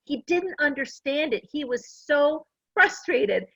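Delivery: usual speaking voice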